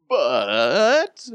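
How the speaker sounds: high, loud, stretched-out BUT; exaggerated wavering caveat; drawn-out hesitant but. Real, not acted. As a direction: stretch the word comically, pitch sliding up. Drawn-out reservation after cheering team.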